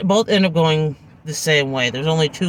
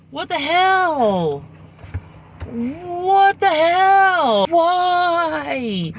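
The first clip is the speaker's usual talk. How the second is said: disbelieving cry; 11 semitones higher, much slower than usual. Real, not acted. Disbelief at the story.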